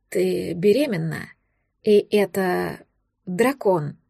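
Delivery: low, slow, awed male voice; stunned dragon, repeating. A narrator's reading. Dragon repeats to Valeria that she carries a dragon, slow and low. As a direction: let it sink in, slowly and low.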